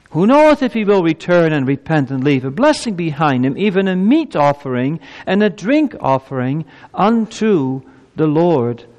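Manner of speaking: high, emphatic scripture reading; scripture proclamation. Acted. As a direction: proclaim the verse with pulpit force. Reads scripture aloud to congregation.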